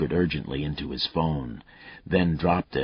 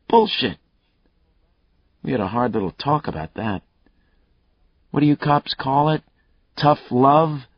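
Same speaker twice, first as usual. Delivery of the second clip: a lightly voiced cocky killer, higher and louder; taunting killer; 7 semitones higher, 5 dB louder than usual. Killer Mayle taunting Lucas over the phone, cocky and loud. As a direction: goad the cop, enjoying himself.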